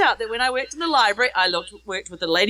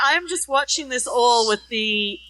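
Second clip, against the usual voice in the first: ordinary talk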